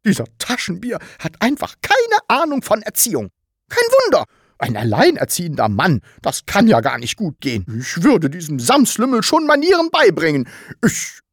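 high-pitched, ranting grumble; huffy, high-pitched tirade; indignant scolding. A narrator's reading. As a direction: scold away to yourself, voice jumping high. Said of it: "schimpfte Frau Rotkohl weiter vor sich hin".

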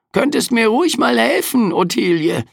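high, sarcastic, weary Reaper; overworked Death complaining; grumpy Grim Reaper. A narrator's reading. Death teases him. (mürrischer Sensenmann)

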